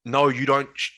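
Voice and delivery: reliving the heated cut-off; triggered outburst. Retold or imitated. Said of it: "I was really emotionally triggered".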